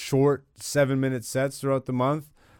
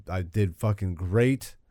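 ordinary talk, nothing marked

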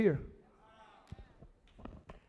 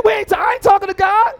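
panicked refusal; 10 semitones higher, 10 dB louder than usual. Acted. People refusing, acted.

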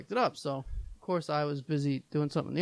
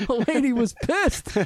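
laughing; 11 semitones higher, 8 dB louder, slower than usual. Real, not acted. Laughing retelling coworker's line.

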